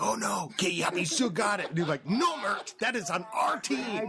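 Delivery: high, worked-up mock protest; mock dismayed spectator. Spectator scolding Mert for rooting against their team, mock alarm. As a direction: plead in exaggerated high-pitched alarm.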